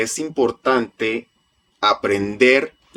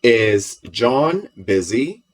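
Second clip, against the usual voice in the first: "Is John busy?" asked very slowly and clearly; slow drill question; much slower than usual. Shown as a demonstration. Teacher asking the class a practice question, slow. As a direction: spell it out word by word for the class.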